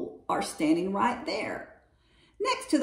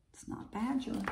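quiet aside; 3 semitones higher, 11 dB quieter than usual. A narrator's reading. Narrator aside.

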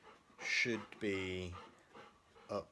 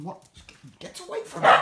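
very high, louder screechy dog voice, fast; voicing the dog; 9 dB louder, faster than usual. Owner voicing his old dog shooing him off, high and screechy. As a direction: squeal it as the grumpy dog. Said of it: "he's going".

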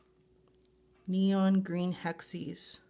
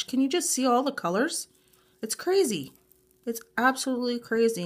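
high, loud, rushing amazement; thrilled, fast and loud; real excitement; 4 semitones higher, 6 dB louder, faster than usual. Real, not acted. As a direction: gush quickly, voice lifted in amazement. Marvelling at shiny holographic glitter colors.

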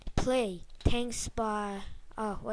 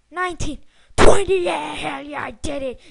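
triumphant shout; 3 semitones higher, 12 dB louder than usual. Real, not acted. Gamer yells the end of the countdown in triumph. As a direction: blast out the last count.

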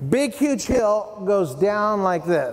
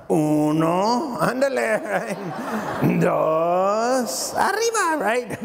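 mock Spanish count; much slower than usual. Best friend counting down in mock Spanish accent. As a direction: ham up a Spanish-style countdown. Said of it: "It's probably gonna look a lot more like this".

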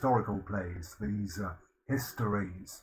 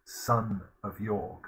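slow, weight on "son"; stress on son; slower than usual. Shown as a demonstration. Lecturer pointing out the pun to the class, slowly. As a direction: lean on "son" to show the pun. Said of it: "you see the pun here".

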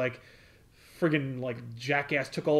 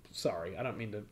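much softer, lower apology; sheepish; 7 semitones lower, 13 dB quieter than usual. Retold or imitated. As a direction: mumble it, embarrassed. Peter backpedals sheepishly.

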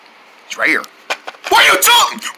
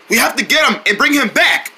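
ordinary delivery

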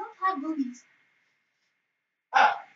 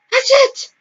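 high, crying, yelling with joy; tearful triumphant shout; 4 dB louder than usual. Acted. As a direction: sob it out, overjoyed and loud.

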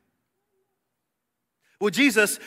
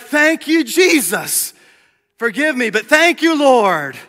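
relieved praise; 7 semitones higher, 8 dB louder, slower than usual. Retold or imitated. Relieved praise after crisis.

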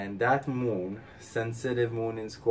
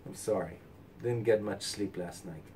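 usual speaking voice

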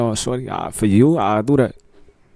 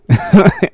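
a loud, high burst of laughter; 9 semitones higher, 7 dB louder than usual. Real, not acted. Cracking up at his own [name] joke, a loud laugh.

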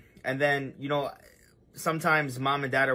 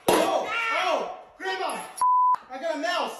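high excited shouting. Acted. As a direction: yell it out in excitement. Excited mouse prank.

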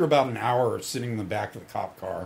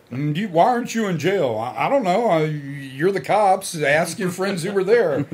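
louder, higher-pitched defensive back-and-forth; retold argument; 5 semitones higher, 5 dB louder than usual. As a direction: replay a heated exchange with a cop.

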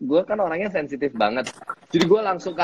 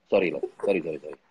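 imitating the partner; 4 semitones lower, faster than usual. Partner pleading sorry to her, low meek voice. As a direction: lower pleading apology. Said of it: "Lo bisa memeranin gue".

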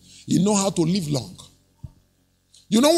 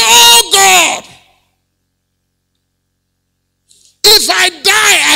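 high, slow loud wail; desperate shouted plea; anguished cry to God; 11 semitones higher, 8 dB louder, much slower than usual. Acted. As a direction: cry out like a dying king. King crying to God.

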